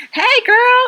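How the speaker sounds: put-on high, loud "girlfriend" hail; mock-cheery greeting. Friend mimicking the article she sent, mock-cheery. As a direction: call it out brightly, sending yourself up.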